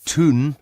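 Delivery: Tun with an oo vowel for town; Geordie word tun. Shown as a demonstration. Teaching the viewer Geordie Tun for town, oo vowel. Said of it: "is town".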